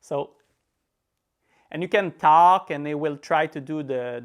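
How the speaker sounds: ordinary talk, nothing marked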